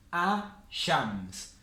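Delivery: 'A-shams' with the L dropped; silent L. Shown as a demonstration. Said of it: "pero no se pronuncia".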